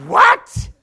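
incredulous high, loud yelp; Pharaoh. Acted. Ruler baffled by the stutterer, acted shrieked disbelief. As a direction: explode in mock disbelief. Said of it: "And Pharaoh's going".

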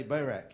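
Barak said as BAY-rak; modelled pronunciation. Shown as a demonstration. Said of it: "Everyone say Barak".

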